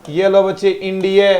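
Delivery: usual speaking voice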